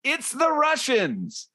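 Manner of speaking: screams out. Acted. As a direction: blurt out the alarm in a drawn-out high yell. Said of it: "And he screams out".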